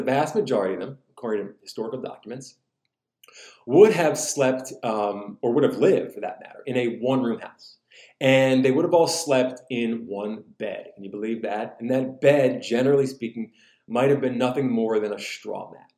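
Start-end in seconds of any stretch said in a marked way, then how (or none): none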